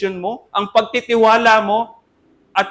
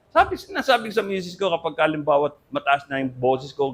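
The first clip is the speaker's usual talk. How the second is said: usual speaking voice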